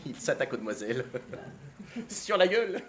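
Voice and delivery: real laughter at his own lines; laughing repeat. Real, not acted. Narrator breaking up at the maids' insults. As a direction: chuckle it out softly. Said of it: "narrator laughs".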